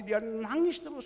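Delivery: ordinary talk, nothing marked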